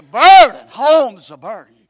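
sudden loud preacher's cry; preaching burst. Acted. Preacher driving home the point to the congregation, loud. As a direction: hit it hard like a pulpit punchline.

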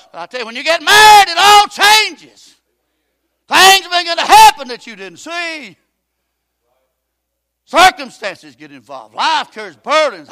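loud, high preacher climbing to a shout; full-force preaching. Acted. Preacher urging the congregation, loud and rising. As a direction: drive it home from the pulpit.

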